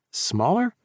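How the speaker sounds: high questioning surprise; baffled rising question; surprised. A narrator's reading. Chase frowning, surprised.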